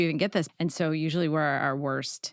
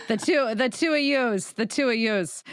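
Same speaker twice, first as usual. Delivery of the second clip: higher, hammed-up Chicago accent; 6 semitones higher than usual. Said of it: "I can't do a good Chicago accent".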